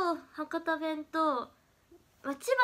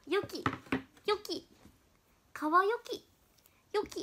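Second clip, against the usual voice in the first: higher, lightly voiced trendy teen girl; imitating a schoolgirl; 7 semitones higher than usual. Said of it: "今時のジェーケーとかジェーディーはよく". (女子高生を軽くまねた高めの声)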